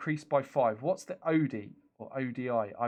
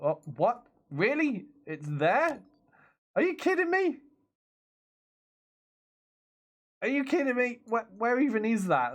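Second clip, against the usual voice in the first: high-pitched disbelief; can't believe what you are seeing; incredulous; 9 semitones higher, 3 dB louder than usual. Real, not acted. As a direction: voice leaps up in baffled protest. Finds mission site absurdly far away.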